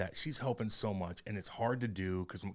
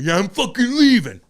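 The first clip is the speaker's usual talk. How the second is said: much higher and louder, yelling as he walks out; storming out; 10 semitones higher, 17 dB louder than usual. Acted. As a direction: shout it like you're slamming the door. Acted storming-out quote.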